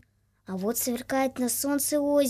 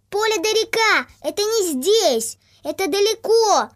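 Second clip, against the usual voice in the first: loud, much higher honking goose; honking bossy goose; 8 semitones higher, 7 dB louder than usual. A narrator's reading. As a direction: scoff and cackle like geese. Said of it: "и загоготали".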